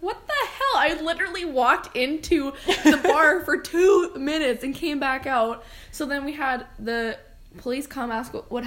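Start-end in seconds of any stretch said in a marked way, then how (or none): 0.0-4.6 high-pitched, half-laughing disbelief; incredulous. Retold or imitated. Telling a friend about a drunk fight, incredulous. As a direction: exclaim it loudly through amusement, stretching the words.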